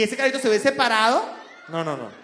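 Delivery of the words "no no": ordinary delivery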